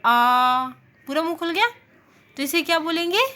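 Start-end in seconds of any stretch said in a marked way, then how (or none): 0.0-0.7 "आ" held with the mouth fully open and loud; wide open aa. Shown as a demonstration. Teacher showing students the open vowel, loud and wide. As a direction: belt out "आ" with the jaw dropped wide. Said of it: "फिर से बोलो".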